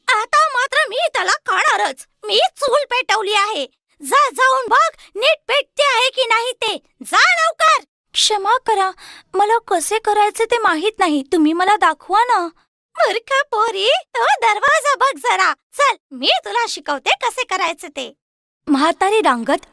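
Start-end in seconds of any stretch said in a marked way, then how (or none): 0.1-7.8 impatient witch. Acted. Witch ordering Gretel to check the oven, high and impatient. 8.1-12.4 put-on higher, pleading little girl's voice; innocent Gretel. Gretel tricking the witch into showing the oven, higher and pleading. 13.0-18.1 high, slow scolding witch voice; scornful witch. Acted. Witch scolding Gretel at the oven, high and scornful.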